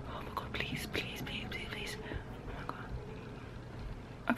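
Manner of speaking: breathy pleading whisper. Real, not acted. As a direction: whisper please under your breath. Whispering while cutting hair.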